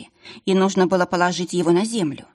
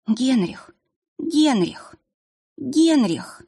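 high, pleading name call; tearful calling; 6 semitones higher, much slower than usual. Acted. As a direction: call him back through tears. Calling to the fallen mage.

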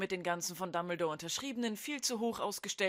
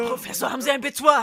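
urgent shout; 4 semitones higher, 9 dB louder, faster than usual. Acted. Harry urges professor.

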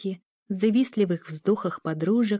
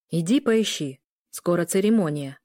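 ordinary delivery

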